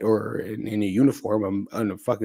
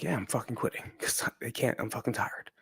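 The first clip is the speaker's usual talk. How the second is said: exhausted unvoiced whisper, much softer; inner voice whisper; 2 semitones higher, 9 dB quieter than usual. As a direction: whisper the thought you'd never dare say aloud. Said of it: "what I was saying in my head".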